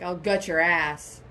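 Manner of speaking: low, slow mimicry, "gut" oddly stressed; misplaced emphasis. Co-host repeating the movie threat, misplaced emphasis. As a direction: drop low and weight "gut" in "I'll gut your ass". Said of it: "And so he was like".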